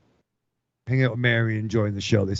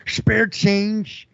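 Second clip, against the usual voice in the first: imitating a panhandler; 8 semitones higher, slower than usual. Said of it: "looks like, um, the cat from, um, U H F".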